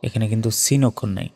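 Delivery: ordinary talk, nothing marked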